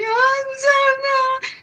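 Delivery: high, slow whiny mock sob; mocking fake crying. Retelling a woman crying at the vigil, mock sobbing high. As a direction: parody her tearful whine. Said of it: "she started crying and being like".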